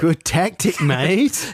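matey put-on Aussie accent; mock Australian accent. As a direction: chime in like an Australian mate. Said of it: "Just carry on in the correct accent".

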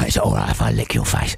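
the family dog asks to lick a face. Acted. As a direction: speak as the slobbering pet dog. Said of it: "he's a dog".

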